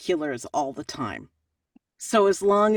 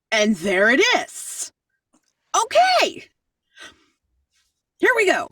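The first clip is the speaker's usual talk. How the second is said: excited okay; 9 semitones higher, 4 dB louder than usual. Retold or imitated. Retelling reaction to the email.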